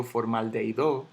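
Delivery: "formaldehído" stressed on the last syllable, for-mal-dei-DO; mis-stressed "formaldehído" as formaldeiDÓ; wrong final stress. Shown as a demonstration. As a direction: rise onto DO in "formaldehído". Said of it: "yo no digo".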